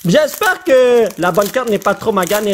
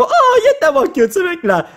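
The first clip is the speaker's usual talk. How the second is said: delighted squeal; 10 semitones higher than usual. Real, not acted. Carte mignonne, attendrie.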